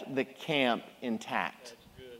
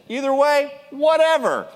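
high, louder careless shrug; shrugging whatever; 9 semitones higher, 5 dB louder than usual. Retold or imitated. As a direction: toss it off like you couldn't care less. Leper shrugs it off.

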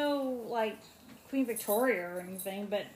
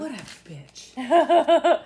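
laughing; 4 semitones higher, 10 dB louder, much slower than usual. Real, not acted. Laughing reaction.

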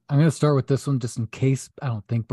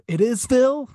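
"It is Phil" said high and announced; movie quote impression; 9 semitones higher, much slower than usual. Friend drops a remembered movie line as a joke. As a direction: throw in the famous line, pitched up. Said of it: "It reminds me of".

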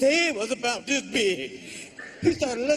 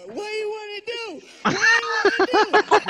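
mock tough challenge; 9 semitones higher, 5 dB louder, faster than usual. Dude challenges to fight.

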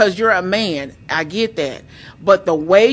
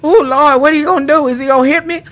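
higher, louder retold alarm; mock nervous; 8 semitones higher, 6 dB louder, faster than usual. Wife replaying her fear of husband, hammed-up nervous. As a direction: play up the panicked inner plea. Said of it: "It would make me nervous".

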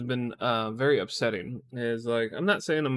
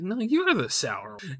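higher, slow taunting imitation; "your mom" retort; 5 semitones higher, slower than usual. Husband retorting like "your mom", higher mocking voice. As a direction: throw it back as a childish comeback. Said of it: "like, your mom kind of thing".

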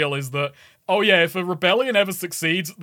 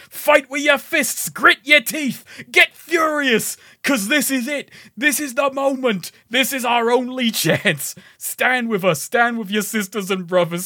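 high, impassioned battle cry in a bad accent, easing toward the end; Jack Paris; 4 semitones higher than usual. Game rebel calling everyone to fight, rousing bad accent. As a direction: fire up the troops in a hammed fake accent. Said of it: "my bad accent".

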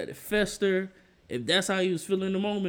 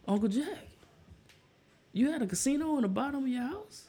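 higher, hesitant disbelief; retold stunned question; 9 semitones higher, 4 dB quieter, faster than usual. Nephew asking Uncle Jack about the casino, stunned. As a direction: pause after the name, then ask incredulously.

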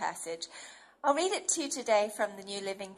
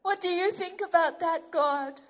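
higher, open-hearted plea to God; a child confessing worries to God; anxious believer; 6 semitones higher than usual. Acted. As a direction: bring your worries to a father. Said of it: "we come to him and we say".

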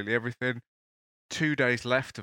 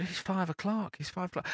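groggy uncle; 10 semitones higher, 5 dB quieter than usual. Retold or imitated. Uncle checks his watch.

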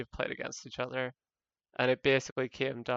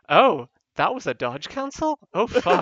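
louder, higher startled realisation; mock dismay; 5 semitones higher, 13 dB louder than usual. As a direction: caught out mid-fight and cursing. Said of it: "It's like".

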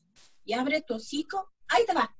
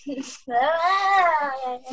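real laugh; 5 dB louder than usual. Real, not acted. Reader breaks up at a mistake, real laughter.

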